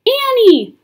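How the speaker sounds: high, loud alarmed call; crying out. A narrator's reading. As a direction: call after her in alarm. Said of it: "cried Jack".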